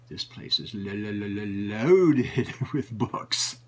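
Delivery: stammered lo- lo- then a slow, laughing loaded; stammering awe. Retold or imitated. Seeing a bookstore packed with books, stammering in delighted awe. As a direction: stumble over the word in delight.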